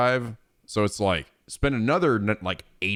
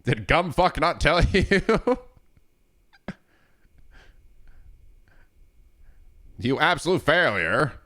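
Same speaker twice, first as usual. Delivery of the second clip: insulting character quote; 6 semitones higher than usual. Retold or imitated. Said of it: "the things that he's saying".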